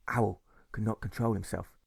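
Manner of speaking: ordinary delivery, nothing marked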